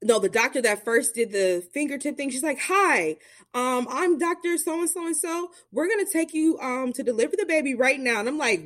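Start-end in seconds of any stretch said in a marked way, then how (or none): 2.6-8.2 doctor's announcement. Retold or imitated. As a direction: calmly break the news. Doctor introducing herself.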